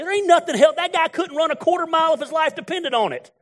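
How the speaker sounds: high, loud, fired-up preaching; preaching at full force. Acted. Preacher railing at the congregation about musclehead guys, full-force preaching. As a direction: let it rip from the pulpit.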